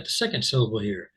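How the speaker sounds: normal talking voice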